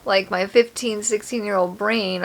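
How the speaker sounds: normal talking voice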